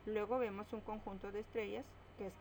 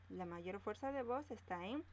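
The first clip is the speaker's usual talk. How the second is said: ordinary delivery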